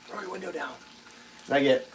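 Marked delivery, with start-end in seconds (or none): 0.1-0.7 mouthed request. Retold or imitated. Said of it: "And I give the".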